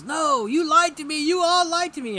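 high, loud, betrayed shouting; furious accusation. Acted. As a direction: yell it at the man who lied to you. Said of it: "he says".

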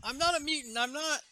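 high-pitched acted begging; mock frightened pleading. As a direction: plead like a cornered little girl, lightly hammed-up. Cornered girl begs her pursuers.

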